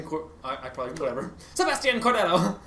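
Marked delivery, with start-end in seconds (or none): 1.5-2.6 high-pitched mock Spanish accent; very thick accent. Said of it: "said it with a very thick accent".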